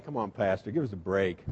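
imitating a congregation member's groan; grumbling complaint. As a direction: mutter it like a fed-up churchgoer. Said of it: "some of you are saying".

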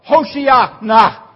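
high, loud, slow Hoshiana shout; mock shout for help. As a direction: yell it like you're going under. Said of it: "you would have said".

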